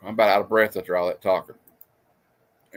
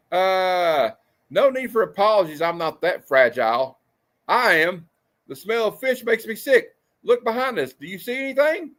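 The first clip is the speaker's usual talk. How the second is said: panel voices; 7 semitones higher than usual. Acted. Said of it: "reading panel dialogue high".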